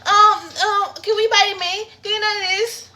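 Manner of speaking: very high, whiny mock relative; exaggerated high-pitched pleading; begging relative. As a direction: imitate family pestering for treats. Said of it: "your family".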